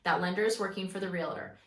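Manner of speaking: ordinary delivery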